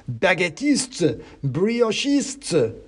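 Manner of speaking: high, drawn-out mouse insults; mock battle cries. A narrator's reading. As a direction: trade cries as squabbling revolutionary mice. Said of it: "voices crying and shouting".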